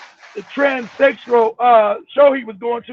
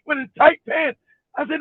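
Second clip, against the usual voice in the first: normal talking voice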